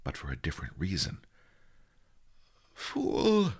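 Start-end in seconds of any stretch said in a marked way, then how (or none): 2.7-3.5 high, strained sleep-talking grumble; cross, high-voiced dream mutter. A narrator's reading. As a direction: scold yourself in your sleep, pitched way up. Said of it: "he muttered".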